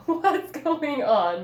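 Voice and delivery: loud, slow, mocking laughing question; laughing, hammed up. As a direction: say it better, laughing at her. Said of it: "let me say that better".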